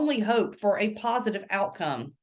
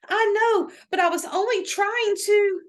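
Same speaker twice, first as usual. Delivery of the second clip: put-on girl protesting; 12 semitones higher, 4 dB louder than usual. A narrator's reading.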